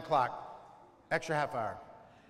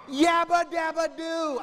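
higher, slow panicked yabba dabba doo; startled yell; 8 semitones higher, 4 dB louder than usual. Retold or imitated. Dad scared on the doorstep, yelling in panic. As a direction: yelp it out in fright. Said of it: "I'm like".

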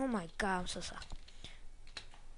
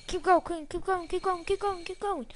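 higher-pitched chant of encouragement; repeated high cheerleader chant; self cheering; 7 semitones higher, much faster than usual. Real, not acted. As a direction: egg the rider on, high and eager. Cheering own character on.

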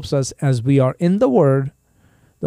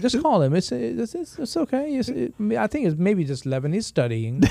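mom's voice; 7 semitones higher, 7 dB quieter than usual. Retold or imitated. Mom voiced urging dad.

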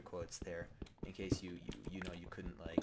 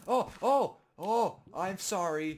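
mock sorry; 10 semitones higher, 11 dB louder, slower than usual. Mock apology while killing sheep.

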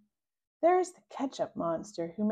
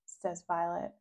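ordinary delivery

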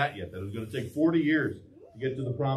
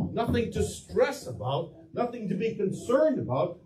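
normal talking voice

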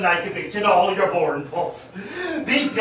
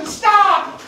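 shouting stop; 11 semitones higher, 6 dB louder than usual. Acted. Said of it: "says Joe".

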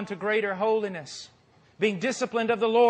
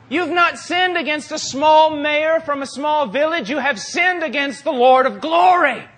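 thundering preacher quote; 7 semitones higher, 8 dB louder than usual. Retold or imitated. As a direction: boom it out like a pulpit warning. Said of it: "He goes".

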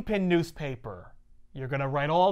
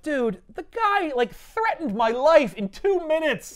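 exasperated; 9 semitones higher, 6 dB louder than usual. Retold or imitated. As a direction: whine in disbelief. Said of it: "Yurik is just like".

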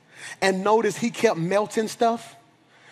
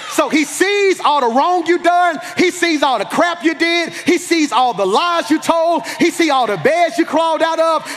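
high, driving sermon litany; preach like feeling it; 6 semitones higher than usual. Real, not acted. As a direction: hammer each repeated phrase with rising fervor. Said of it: "help me preach like I feel it".

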